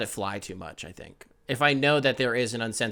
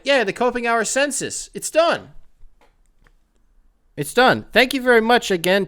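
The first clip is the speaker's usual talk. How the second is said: high, loud declaration; triumphant; 11 semitones higher, 7 dB louder than usual. Real, not acted. Host triumphantly declaring the census finished, loud and high. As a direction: announce it like a victory.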